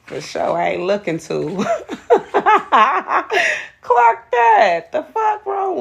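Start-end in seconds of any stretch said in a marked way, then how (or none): 1.5-5.8 shrieking with laughter, much higher and louder; high laughing. Real, not acted. Reader laughing at a rude viewer, high loud laughter. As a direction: crack up, voice squealing up high and loud.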